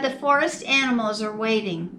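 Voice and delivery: plain reading voice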